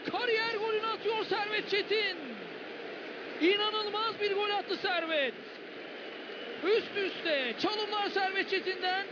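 ordinary talk, nothing marked

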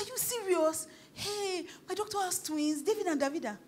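high-pitched disbelief, acted out; incredulous storytelling voice; retold astonishment. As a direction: replay the stunned discovery with pitch jumping up. Retells her shocked discovery.

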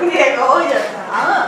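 loud laughing call. Real, not acted. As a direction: laugh out the name. Laughing at partner.

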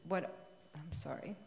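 normal talking voice

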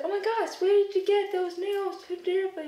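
high-pitched mock amazement; acted excited questioning; imitating impressed admirers. Acts out admirers of her nails.

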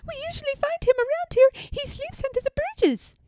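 very high, loud bird voice; squeaky storybook sparrows; calling sparrows. A narrator's reading. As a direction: chirp directions like a small bird. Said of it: "they call".

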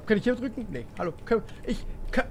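ordinary talk, nothing marked

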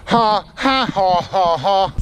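spelt-out dry ha ha; mock laugh, each ha flat; sarcastic fake laugh. Hiker mocking his own bad luck, dry fake laugh.

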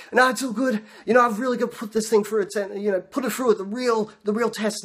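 high-pitched overkeen reassurance; flustered bluffing. Acted. Rider pretending to test the bike, flustered and high. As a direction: bluff your way through, voice pushed up high. Sweating rider covers for himself.

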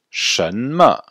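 Shenme spoken at half speed; slow model word. Shown as a demonstration. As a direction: model Shenme slowly for students. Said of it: "Répétez". (Shenme, très lentement)

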